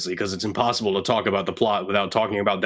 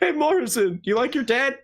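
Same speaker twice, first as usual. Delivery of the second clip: mock interviewer; 14 semitones higher than usual. Mock interviewer asks Morrison.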